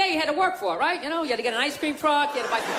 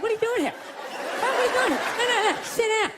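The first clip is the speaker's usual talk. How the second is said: mock TV host; 7 semitones higher than usual. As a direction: confront him like the predator-show host. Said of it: "catch a predator guy to come walking out like".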